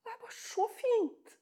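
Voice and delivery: soft and moved. Real, not acted. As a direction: let it fall quietly, moved. Preacher savours the moment. (stille og rørt)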